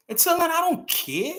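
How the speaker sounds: high defiant. Real, not acted. Defiant declaration, said twice.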